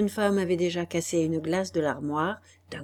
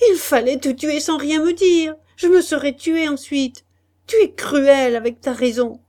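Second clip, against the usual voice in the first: high, loud anguished reproach; Guillaume in despair; 8 semitones higher, 9 dB louder than usual. Acted. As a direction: cry it out in despair. (cri de désespoir, voix haute et forte)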